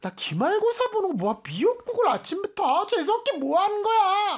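acting a whiny, high-pitched tantrum at mom; mock snapping at mom, pitch shooting way up; touchy exam-season outburst. Student lashing out at mom over breakfast soup, high mock-angry whine. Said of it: "예민해져서 엄마한테 괜히 화내잖아".

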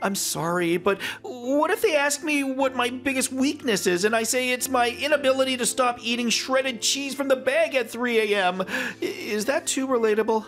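high-pitched, flustered apologizing; comically panicked candidate; frantic nerves. Acted. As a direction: ramble anxiously, dragging out the self-pity at the end. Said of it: "It's making you look frantic".

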